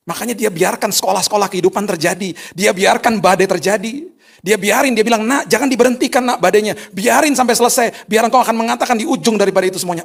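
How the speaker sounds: loud, high, impassioned preaching; full-force preaching. Acted. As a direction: drive it home to the congregation.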